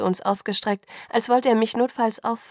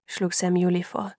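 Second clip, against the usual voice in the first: ordinary delivery, nothing marked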